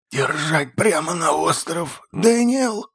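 high, breaking urgent whisper; urgent backstage whisper. A narrator's reading. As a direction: hiss the order with a cracking voice. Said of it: "срывающимся шёпотом скомандовал".

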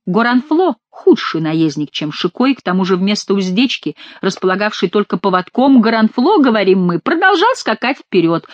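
ordinary delivery

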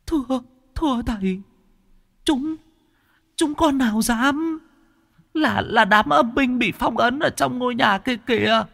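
high, thin, cowering ghost voice, stuttering; a frightened spirit begging the master; stammering ghost. Acted. Ghost answering the exorcist, high and stammering in fear. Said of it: "Nó lắp bắp".

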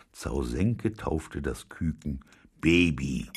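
ordinary delivery, nothing marked

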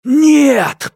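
high, loud hoarse cry; hoarse shout. Acted. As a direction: rasp out a defiant shout. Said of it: "прохрипел он".

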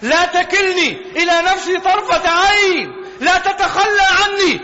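rising, impassioned supplication; fervent plea to God; pleading prayer. Acted. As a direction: beg God not to abandon you, building.

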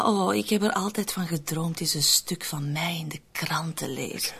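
soft unvoiced whisper, trailing off; dreamy whisper. Woman whispering her dream of being in the paper. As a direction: whisper it like a secret wish. Daydreaming aloud about fame.

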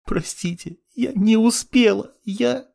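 high, broken sobbing apology; blubbering. A narrator's reading. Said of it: "захлёбываясь слезами".

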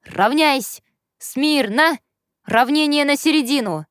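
loud, high drill commands; barked commands. Acted. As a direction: bark out the parade orders. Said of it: "как гаркнет".

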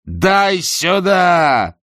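slow, loud grabbing shout. A narrator's reading. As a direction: bark it as you snatch. Husband snatches paper, shouting. (громкий резкий окрик)

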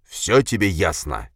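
slow, pointed challenge; menacing demand. Acted. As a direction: spell the question out slowly as a warning. Boss finishes his threat.

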